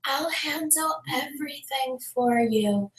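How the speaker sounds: soft, slow, drawn-out imitation; mimicked slow talker. Property lady promises to take care of it, slow and soft. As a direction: stretch every word softly, mocking her delivery. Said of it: "Just like how she talks like that".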